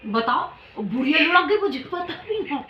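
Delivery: normal talking voice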